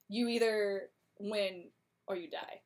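ordinary talk, nothing marked

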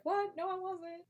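high shocked what, then quick denial; indignant denial. Retold or imitated. Queen denying Richard's slander, high indignant shock. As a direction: deny it in sharp disbelief. Elizabeth denies Richard's accusation.

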